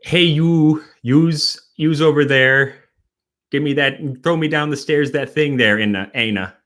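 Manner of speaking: imitating a Wisconsin parent hollering; lightly hammed Wisconsin accent. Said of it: "What is it? Is".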